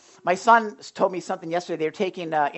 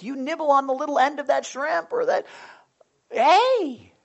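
fish grabbing bait; 7 semitones higher than usual. Acted. Acting the fish taking the bait.

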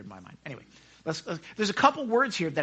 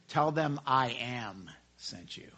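God; 7 semitones lower, 8 dB quieter than usual. Acted. Voices God answering frantic Moses.

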